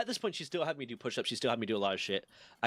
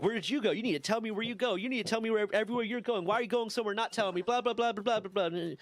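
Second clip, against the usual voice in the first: high, whiny nagging; mocking a nagging sergeant, speeding up into blah blah blah; 8 semitones higher than usual. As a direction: lampoon the sergeant's scolding, pitched way up. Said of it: "Sergeant starts drilling into me".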